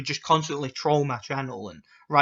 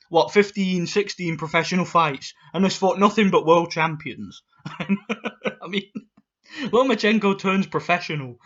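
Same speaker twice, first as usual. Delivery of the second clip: usual speaking voice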